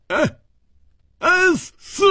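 high, sudden battle cry; explosive high-pitched yell; shouted command. A narrator's reading. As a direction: cry out sharply, pitch leaping right up. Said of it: "嘴里却大声地喝道".

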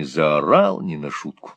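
ordinary delivery

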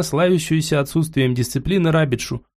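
plain reading voice

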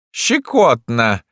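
high, drawn-out gleeful cry; tickled, stretch it out high; laughing emperor. A narrator's reading. The emperor laughs off the stabbing, gleeful. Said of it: "хохотал император".

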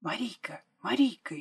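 hushed, urgent call of a name; frightened calling. A narrator's reading. Girl at the fence crack to her friend, frightened. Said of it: "испуганно замахала она руками".

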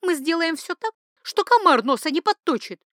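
high-pitched, eager insistence; insistent. A narrator's reading. Duchess clings to her plan, insistent and eager. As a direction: press the plan in a high, loud voice. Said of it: "не унималась Каталина".

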